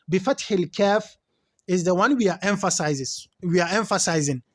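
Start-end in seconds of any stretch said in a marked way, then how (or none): none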